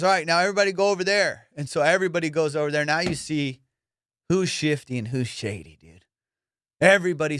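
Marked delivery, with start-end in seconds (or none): 0.0-1.5 announcing guard. Retold or imitated. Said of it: "comes back and goes".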